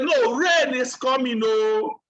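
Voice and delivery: slow, drawn-out insistent call; Noah shouting back. Retold or imitated. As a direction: call the warning out over doubters. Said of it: "Noah was shouting".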